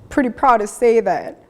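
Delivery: real emotion welling up, trying not to cry; proud words through held-back tears; holding back tears. Real, not acted. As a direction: voice catching with emotion, fighting tears. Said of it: "try not to cry here".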